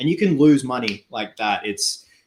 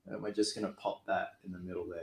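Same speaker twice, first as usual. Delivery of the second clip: ordinary talk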